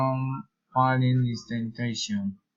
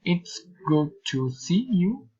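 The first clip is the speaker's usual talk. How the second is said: high exclamation swooping on "see you"; high-pitched, word-by-word exclamation; exclamatory intonation; 5 semitones higher than usual. Shown as a demonstration. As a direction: let "see you" leap and curve. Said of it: "Exclamatory sentences".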